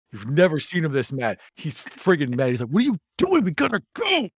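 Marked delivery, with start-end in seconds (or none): 2.7-4.3 much higher, louder furious shout; angry yell. Acted. Orion yelling at Dawn to stop, angry yell. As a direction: yell it at her, really mad. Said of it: "He's really mad".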